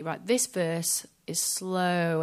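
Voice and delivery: slowed right down, drawn out. Shown as a demonstration. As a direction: say it slowly like a slow verse. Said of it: "be able to say".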